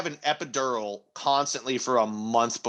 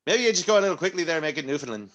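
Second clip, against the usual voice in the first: quick, higher, Irish-sounding Newfoundland patter; put-on Newfie accent, very Irish; 4 semitones higher, faster than usual. Shown as a demonstration. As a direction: rattle it off in mock-Newfoundland. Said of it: "that sounded super Irish".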